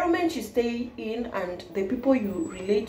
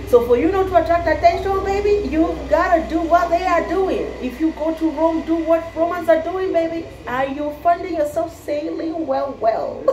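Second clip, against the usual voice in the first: playful sassy; 8 semitones higher than usual. Real, not acted. Playful advice to viewers.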